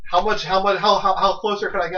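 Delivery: loud, high-pitched protest; exasperated defence. Real, not acted. Defending himself in the argument.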